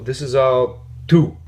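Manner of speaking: tú stretched and stressed. Shown as a demonstration. Teacher stressing tú to learners. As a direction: lean on the word tú.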